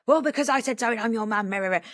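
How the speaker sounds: lightly voiced bossy mum, rah rah; a hint of a dismissive parent brushing it off; understated know-it-all mum voice; mock bossy mum. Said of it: "easy for some parents to go".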